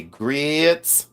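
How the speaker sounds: GRITS stretched long as "greets"; mock Southern "greeeets"; imitated Southern drawl. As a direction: draw out the vowel in GRITS, mimicking Southerners. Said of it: "I love their accent".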